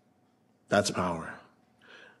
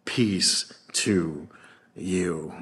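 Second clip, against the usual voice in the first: soft, low blessing; a hushed, reassuring greeting; gentle Jesus; 6 semitones lower, 8 dB quieter, much slower than usual. Acted. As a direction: lay peace on them gently. Jesus greets the frightened disciples.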